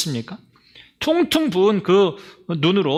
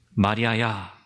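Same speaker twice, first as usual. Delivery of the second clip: tender call; 8 semitones lower, slower than usual. Acted. Jesus gently calling Mary by name. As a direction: call her name gently, like the risen Lord.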